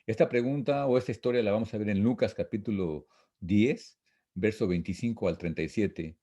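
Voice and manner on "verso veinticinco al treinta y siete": ordinary delivery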